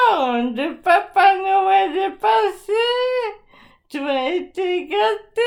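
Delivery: put-on high, sing-song pixie voice for a child. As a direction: talk to her like a cheery little pixie. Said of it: "Il a pris une voix de farfadet".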